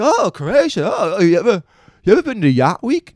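very high, loud, gushing stranger; mock over-excited small talk, voice way up; gushing excitement. Mimics people hearing he's Croatian.

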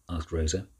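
usual speaking voice

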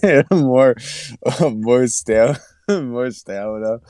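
real giggles breaking up the words; laughing. Real, not acted. Trying to finish a football point, laughing. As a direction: try to get it out through laughter. Giggling fit over repeated word.

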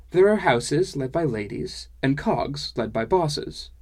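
dwarf woman giving an emphatic lesson; explaining dwarf woman. Acted.

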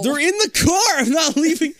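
whiny protest. Real, not acted. Protesting to friend.

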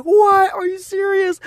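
very high, squealed incredulous question; shocked disbelief. Retold or imitated. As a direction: imitate astonished roommates, starting loud. Acting out roommates' shocked reaction.

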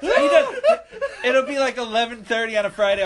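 normal talking voice

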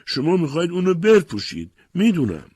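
high, loud cry of protest; acted mother's outcry, high and loud; screaming. A narrator's reading. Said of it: "شروع به جیغ زدن کرد".

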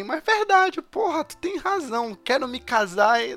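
high, hammed-up airhead voice; mock naive girl. Friend mocking Queenie, a high airhead voice. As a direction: play her as a dreamy fool. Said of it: "Eu só te vi".

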